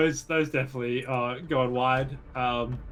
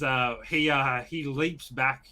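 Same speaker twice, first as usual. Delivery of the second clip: normal talking voice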